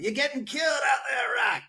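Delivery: high, shouted Adrian cry from the corner. Retold or imitated. Retelling the Lipton ad, Adrian shouting at Rocky. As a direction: squeal it like a panicked claymation Adrian. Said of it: "claymation Adrian".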